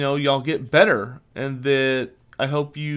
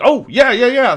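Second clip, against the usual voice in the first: eager yes; 8 semitones higher, 8 dB louder than usual. Retold or imitated. Relieved reply to fast plumber.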